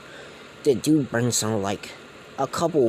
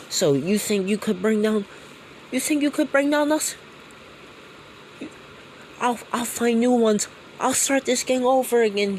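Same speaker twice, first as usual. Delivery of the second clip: taunting villain; 12 semitones higher than usual. Acted. Gang leader mocks Deku in a very high voice. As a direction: taunt him, rising to a shriek.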